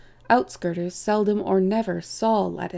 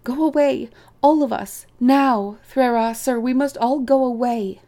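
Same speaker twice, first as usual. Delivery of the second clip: high, loud, insistent warning; loud alarmed pleading; urgent plea; 4 semitones higher, 6 dB louder than usual. A narrator's reading. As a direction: beg them all to flee, phrase by phrase. Frightened rabbit urges everyone to flee.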